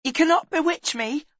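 put-on high, loud boyish blurt; sudden blurt. A narrator's reading. Boy suddenly challenges reputed witch, blurting. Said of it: "he said suddenly".

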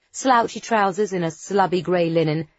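ordinary delivery

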